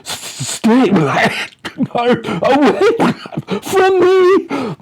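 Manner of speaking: very high, loud, stretched panicked cry; terrified shriek. A narrator's reading. Naked Mister Muldoon shrieking at the tent, very high and terrified. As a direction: shriek it at the monster in the dark. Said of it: "Cries Mister Muldoon".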